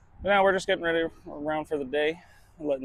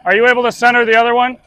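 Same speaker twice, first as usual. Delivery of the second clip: loud, higher and brisk call across to someone; 9 semitones higher, 11 dB louder, faster than usual. Real, not acted. Driver asking the loader about the load, calling out loudly. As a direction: project the question loudly to a worker.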